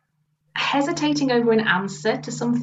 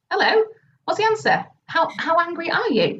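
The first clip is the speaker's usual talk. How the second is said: high, pushy nudge for an answer; impatient prompting; 7 semitones higher than usual. Retold or imitated. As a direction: prod her to finally answer. Retelling pushing a silent client.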